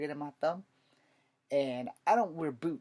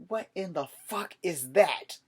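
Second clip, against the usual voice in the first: slow, loud, higher demand; loud disbelief; 5 semitones higher, 7 dB louder, slower than usual. Retold or imitated. Soldier demanding to know about the roach, slow loud outburst. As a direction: blurt it out slow and loud at the sergeant. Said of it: "And I said".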